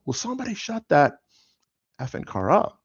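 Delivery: high, exasperated outburst at the car; exasperated yell. Retold or imitated. Dad on the phone to 911, yelling at the car, exasperated. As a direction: snap at the noisy car, pitch flying up.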